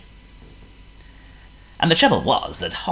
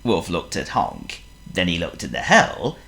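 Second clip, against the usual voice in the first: plain reading voice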